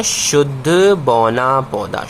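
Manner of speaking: slowed, शुद्ध leaned on; slow emphatic answer. Shown as a demonstration. Teacher to students, slowly naming the pure dwarf plant. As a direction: hand the class the term slowly. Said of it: "तो क्या बोलना पड़ेगा?".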